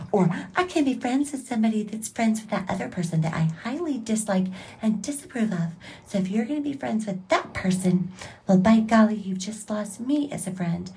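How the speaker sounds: high, prissy voice of a judgmental friend; lightly voiced snooty friend cutting people off; mock judgmental friend. A judgmental friend dropping someone, high and prissy.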